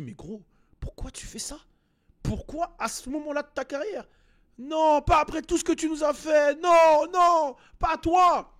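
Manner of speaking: rising high to a shouted plea of disbelief; no, not you, loud and high; dismayed fan outcry. Retold or imitated. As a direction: cry out like a betrayed fan. Imagined fan's dismayed outcry.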